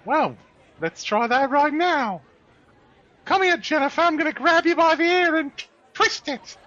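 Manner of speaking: very high, loud put-on mother voice; hammed-up mum laying down the law; scolding mother. Mother seizing Jennifer by the ear, high loud scolding.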